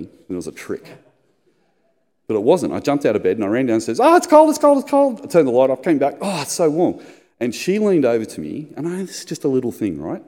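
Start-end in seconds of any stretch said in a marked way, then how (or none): 4.0-5.2 high, loud squeal against the cold; shivering whine. Retold or imitated. Husband running downstairs to the light, high shivering whine. 6.2-7.0 contented sigh. Retold or imitated. Back into the warm bed.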